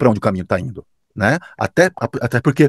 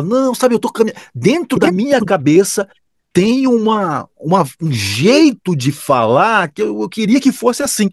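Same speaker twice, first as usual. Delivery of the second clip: higher, searching client voice; imitating client; 9 semitones higher than usual. Client explaining the voice they imagine, higher imitation. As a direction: play the unsure client groping for words.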